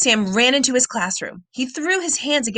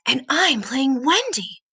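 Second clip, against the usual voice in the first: whispered line; 4 semitones higher than usual. A narrator's reading. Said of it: "Lucy whispered".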